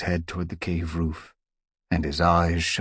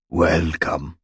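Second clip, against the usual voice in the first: rasping voice; 6 dB louder than usual. Acted. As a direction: rasp out a solemn greeting. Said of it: "he meowed in a rasping voice".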